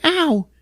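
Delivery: mock yelp. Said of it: "and she's like".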